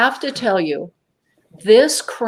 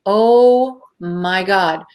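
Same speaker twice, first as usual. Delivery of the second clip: retold awe; 3 dB louder, much slower than usual. Recalls first seeing the craft.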